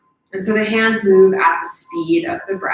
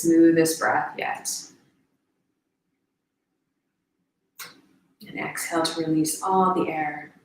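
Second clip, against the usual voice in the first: guided meditation; 6 dB quieter than usual. Real, not acted. Guide counting down the last breaths, soft meditation voice. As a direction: let each instruction settle, quiet and unhurried.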